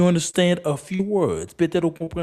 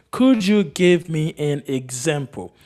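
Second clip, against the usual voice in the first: slow, word-by-word model question; slow repeat; slower than usual. Shown as a demonstration. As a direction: repeat it slowly for learners. Slower repeat for learners.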